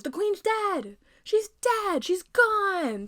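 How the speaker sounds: high, loud cheer; guards cheering. Acted. Guards shouting for joy at the queen's death. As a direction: whoop it out in triumph.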